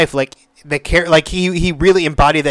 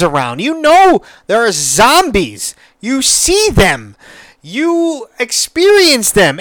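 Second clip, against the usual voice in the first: exasperated rant; 6 semitones higher, 3 dB louder than usual. Retold or imitated. Reviewer scolding the daughter character, exasperated rant. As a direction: scold her like she can't hear you. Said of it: "I'm just like".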